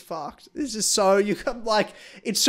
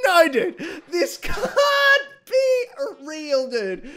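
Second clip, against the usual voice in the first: high, laughing, half-crying protest; laughing disbelief; 7 semitones higher, slower than usual. Retold or imitated. Retelling her reaction to the spanking scene, laughing protest. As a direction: plead it through giggles. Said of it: "I'm like".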